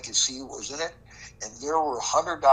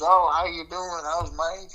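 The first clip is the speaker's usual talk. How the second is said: bright, higher imitated greeting; mock friendly pleasantries; 6 semitones higher, 5 dB louder, slower than usual. Imitating the briber's chummy hello to Greg. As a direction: play up his chummy small talk. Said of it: "he's first with the pleasantries".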